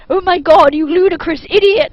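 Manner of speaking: two caught lovers yelling at the intruder, loud and angry; angry shout. A narrator's reading. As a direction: bellow it at the idiot in outrage. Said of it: "they both shouted angrily".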